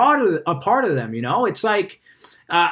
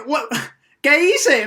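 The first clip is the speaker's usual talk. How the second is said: startled confusion; 7 semitones higher, slower than usual. Retold or imitated. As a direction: play a stranger who's suddenly scared, what did I do. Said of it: "They're like".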